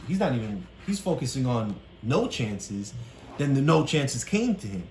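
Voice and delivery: ordinary talk, nothing marked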